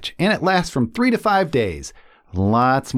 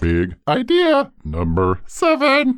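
announcer voice; 10 semitones higher than usual. Acted. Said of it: "Voice, are you ready?".

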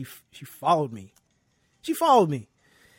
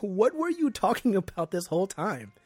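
higher, louder, mock-baffled professor; professor's incredulous question; 5 semitones higher, 4 dB louder than usual. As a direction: ask it as if truly baffled. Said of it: "And he said".

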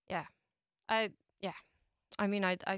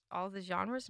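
normal talking voice

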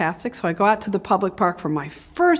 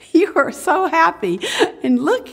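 slow, high-pitched, tender fondness; sweet elderly mom; 9 semitones higher than usual. Retold or imitated. Mom at eighty-nine looking back with her daughter, sweet. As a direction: imitate a doting mother, slow and lifted. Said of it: "Like, she's so sweet".

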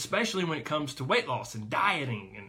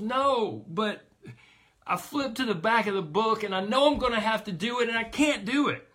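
put-on panicky child; 7 semitones higher, 4 dB louder than usual. Child panicking about book.

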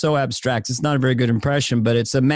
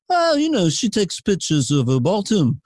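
put-on Liverpool accent, starting high and falling; lilting Scouse musician impression; 4 semitones higher than usual. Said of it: "that was [name] talking".

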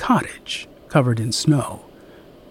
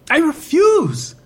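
firm refusal. Acted. Woman repeating her refusal aloud, high and firm.

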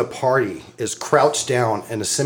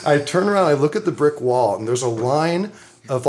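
ordinary talk

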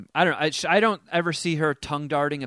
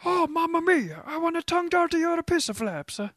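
high hammed-up Italian accent; cartoon Italian, pitched way up; mock Italian accent. Host answering the caller's accent question in Italian. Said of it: "Spanish or Italian accent".

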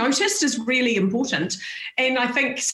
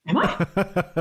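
laughing surprise; 6 semitones lower than usual. Retold or imitated. Surprised at friend's bluntness.

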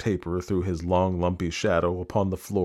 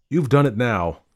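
higher, louder, blunt reproach; accusing burglar; 5 semitones higher, 7 dB louder than usual. Acted. Burglar confronts the lady after the killing, accusing. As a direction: confront her as a shocked witness.

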